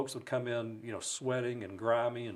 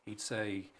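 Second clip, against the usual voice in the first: ordinary delivery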